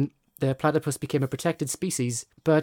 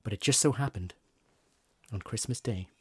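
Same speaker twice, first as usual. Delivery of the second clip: fireside storytelling; 4 semitones lower than usual. Acted. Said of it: "You've got your storytelling voice on".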